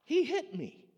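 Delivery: put-on high, loud kid complaint; indignant child shouting; child's yell. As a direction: yell it like an upset kid in the back seat. Kid yelling in the van.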